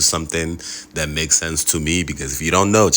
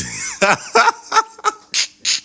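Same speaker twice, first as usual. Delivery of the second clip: high-pitched laugh; 3 dB louder than usual. Real, not acted. Power returns, bursts out laughing.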